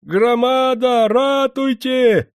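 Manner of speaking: very high, long-drawn wail for help; loud, sustained high call; old man's cry. A narrator's reading. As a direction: cry out to the whole village, stretching each word. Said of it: "закричал понявший всё дед".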